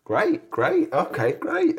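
repeated words climbing high at the end; dazed, acted-out acceptance rising in pitch; retold slow realisation. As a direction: act out catching up with the news. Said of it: "it took me a moment to catch up".

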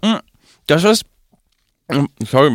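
words round a bite of food; speaking while chewing; mouth full. Real, not acted. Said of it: "warte, schluck kurz runter".

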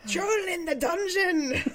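high, loud, drawn-out Northern English cry; hammed-up Northern English accent, slowly calling the alarm; mock alarm in a broad Northern English accent. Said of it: "imagine if he played this with his normal accent".

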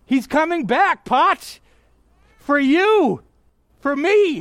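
excited yell, very high and loud. Real, not acted. Preacher yelling excitedly that the Lord is coming back. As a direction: shout the promise over the congregation. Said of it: "Sorry to yell".

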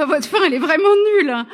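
loud, high, laughing verdict; laughing mock outburst. Producer telling the writer her ending is useless, loud and laughing. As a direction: blurt it out through a laugh, imitating the producer.

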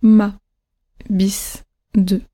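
"ma", "bis", "de" said low, each descending; neutral falling tone. Shown as a demonstration. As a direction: neutral single words with a full-stop drop. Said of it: "j'essaye de parler vraiment le plus neutre possible".

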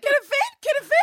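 very high, loud, sing-song taunting; high giddy mock glee; playful taunt. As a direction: tease like a child playing a game.

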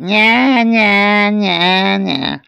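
put-on high, loud whining baby cry; mock baby wail. A narrator's reading. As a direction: imitate a baby's nagging wail, sinking at the end. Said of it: "lay in the cradle and he went".

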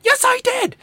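very high, squawked comeback; hammed-up shrieky insistence; mock shouted bickering. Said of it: "just a shouting back and forth".

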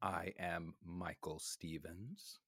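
low and deliberate self-introduction; slow, low dramatic intro. Acted. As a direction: announce your name slowly, hushed.

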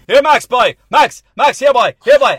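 loud high calling out; calling the dog. Retold or imitated. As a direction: shout for the dog across the yard. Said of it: "my grandfather would go".

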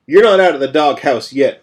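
loud scolding jab. A narrator's reading. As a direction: scold him sharply. Scolding after chest slap.

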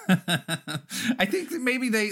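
chuckling through it; laughing. Real, not acted. Laughing start of answer.

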